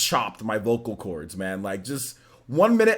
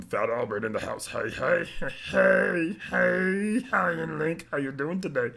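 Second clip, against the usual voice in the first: Fat Albert voice; 3 semitones higher, 4 dB quieter than usual. Retold or imitated. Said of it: "I can do Chuck Taylor".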